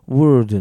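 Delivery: slow, loud "word" with curled r and no l; clear pronunciation demo. Shown as a demonstration. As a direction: model "word" with one curl of the tongue. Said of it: "発音の違いをしっかり耳で".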